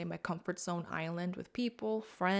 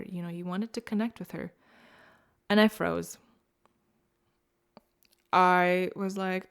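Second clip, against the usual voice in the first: ordinary talk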